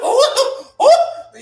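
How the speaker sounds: very high, loud laughing ooh; mock excited ooh. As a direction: squeal it like a stunned fan. Mocking critics impressed.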